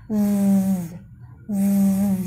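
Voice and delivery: Z as a long, low throat buzz, tongue out; buzzing voiced TH. Shown as a demonstration. Teacher demonstrating a hard English sound, long buzzed Z. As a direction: hold "Z" as a vibrating hum through the teeth. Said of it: "hai răng chạm nhẹ và rung cổ họng".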